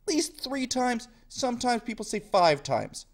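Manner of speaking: normal talking voice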